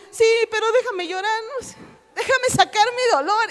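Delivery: a hammed-up whimper to be left alone; mock tearful plea. Preacher imitating herself begging to keep crying, hammed-up tearful.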